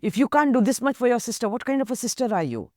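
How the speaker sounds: urging, egging on. Retold or imitated. Relatives pressure the young sister, loud and fast. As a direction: hammer at her to give in. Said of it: "Everybody else egging her on, urging".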